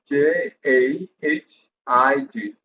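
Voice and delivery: letter by letter, unhurried; slow spelling out. Shown as a demonstration. Teacher spelling a name slowly for students. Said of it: "spelling out a name".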